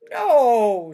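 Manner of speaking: loud, booming frog reply. Acted. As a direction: boom it out like a frog. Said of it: "said the frog".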